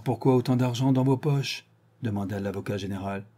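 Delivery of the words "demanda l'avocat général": ordinary delivery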